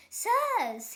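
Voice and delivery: high, loud formal address; a rich man hailing the zoos; grand mock formality; pompous father. A narrator's reading. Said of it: "he said".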